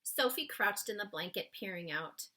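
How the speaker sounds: ordinary delivery, nothing marked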